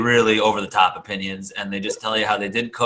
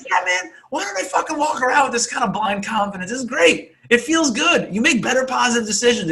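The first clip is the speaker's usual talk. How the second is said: mock inner voice; 12 semitones higher than usual. Imagined inner voice wanting confidence.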